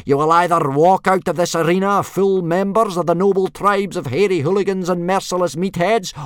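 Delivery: loud, high drill-sergeant bellow; yelling. A narrator's reading. Instructor announcing the initiation test, yelling. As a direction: bellow a speech across an arena. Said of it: "yelled Gobber".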